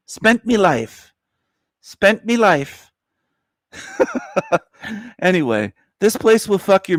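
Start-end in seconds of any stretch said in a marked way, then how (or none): 0.1-2.9 put-on high, drawn-out Irish accent; mock Irish accent. Streamer playing with a viewer's phrase, Irish accent. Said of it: "or an Irish accent". 3.8-4.6 high, soft giggle; laugh. Real, not acted. Streamer amused by own Irish accent, high giggle.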